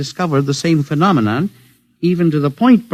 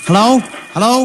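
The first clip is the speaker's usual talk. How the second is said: raised calls into the radio; calling out; 8 semitones higher, 4 dB louder than usual. Acted. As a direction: call loudly to be heard over the set.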